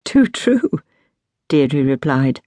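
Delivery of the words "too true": high, amused agreement; lightly amused reply; laughter in voice. A narrator's reading. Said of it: "laughter still echoing in her voice".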